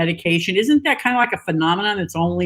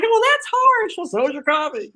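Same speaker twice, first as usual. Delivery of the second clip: offended wife; 11 semitones higher than usual. Retold or imitated. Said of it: "She's like".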